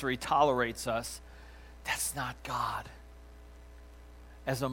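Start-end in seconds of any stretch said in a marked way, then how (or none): 1.8-2.9 That's not God. whispered slowly, much softer. Real, not acted. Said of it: "repeated line, plain then whispered".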